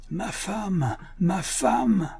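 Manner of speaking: a drawn-out cry carried off on the wind; triumphant cry. A narrator's reading. Hankins racing away with Lottie, high triumphant cry. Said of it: "Le cri se mêla encore un instant au hurlement du vent".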